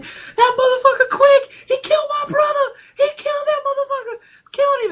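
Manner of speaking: very high, loud falsetto wail; hammed-up sobbing shriek, imitating a movie gangster; mock bawling. Retelling a gangster chasing his brother's killer, mock bawling. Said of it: "he's, like, bawling his eyes out".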